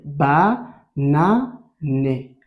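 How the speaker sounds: very slow "ba na né", each part separate; syllable by syllable. Shown as a demonstration. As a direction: break "ba na né" into three drawn-out beats. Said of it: "Répète avec moi". (« ba na né » très lent, syllabes séparées)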